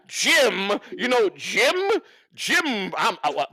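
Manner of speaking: ordinary talk, nothing marked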